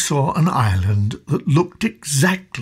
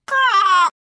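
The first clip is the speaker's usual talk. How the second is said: high, loud bird squawk; crow caw; 5 dB louder than usual. A narrator's reading. Crow refusing to share with a parrot, high loud caw. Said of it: "said the crow".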